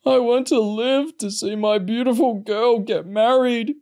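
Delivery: high, wailing, maudlin plea; moaning. A narrator's reading. Sick drunk man fearing death, wailing. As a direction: wail it self-pityingly. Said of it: "Mister Large moaned".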